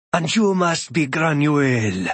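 slow, resonant stranger's voice, lightly voiced; measured and courteous, a hint of a god; a lightly voiced, unhurried thunder god; thrumming bass voice. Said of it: "His voice is a thrumming bass".

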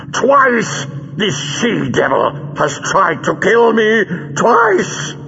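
high, strained villain fury, drawn out; hoarse with rage. A narrator's reading. Villain who was nearly killed, hoarse with rage. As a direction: rage with the memory of fear still in it. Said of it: "His voice was hoarse with rage".

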